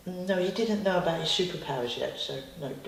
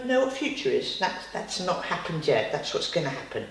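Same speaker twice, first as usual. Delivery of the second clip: usual speaking voice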